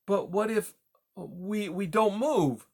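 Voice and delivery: high, faltering little mouse; worried cry. A narrator's reading. Mouse asks what happens if they don't move, anxious and halting. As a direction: stumble into the question, anxious. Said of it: "Poppy cried".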